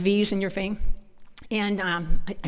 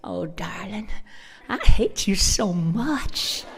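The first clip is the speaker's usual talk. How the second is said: mock-sweet drawn-out Southern put-down; sugar-coated Southern hate; 8 dB louder, much slower than usual. Shown as a demonstration. Speaker mimics how Southerners insult someone, mock-sweet. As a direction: stretch it out as a syrupy fake endearment. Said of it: "this is how you'd say it".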